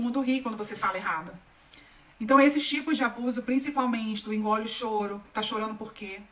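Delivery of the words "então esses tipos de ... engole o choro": ordinary delivery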